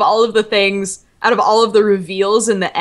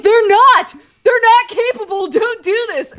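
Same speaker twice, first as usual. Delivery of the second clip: high, pleading mock outrage; pitched-up retold protest; exasperated protest; 11 semitones higher than usual. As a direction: argue back at the story in exasperation. Acted objection to the god's offer.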